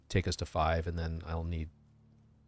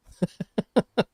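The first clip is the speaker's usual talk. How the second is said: sudden high laugh; high burst of laughter; 11 dB louder than usual. Real, not acted. Amused by a chameleon drop.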